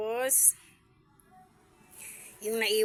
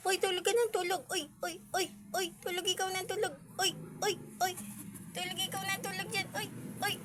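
tiny high, softened pet voice; 9 semitones higher, 4 dB quieter than usual. Real, not acted. Baby-talking to a sleeping pet.

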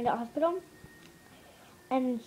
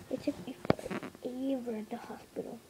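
ordinary delivery

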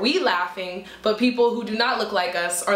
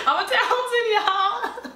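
high, giggly mock boasting; silly high-pitched brag through a laugh; mock show-off; 13 semitones higher, much slower than usual. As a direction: act the fool showing off, way up high.

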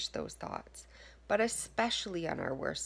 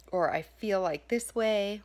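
ordinary talk

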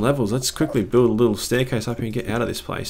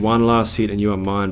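usual speaking voice

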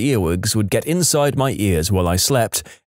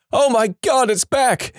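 high-pitched fake alarm; mock yell; 12 semitones higher than usual. A narrator's reading. Sibling scaring brother about killer moth, high mock yell. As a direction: cry out in staged panic, pitched way up. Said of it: "I look up and yell".

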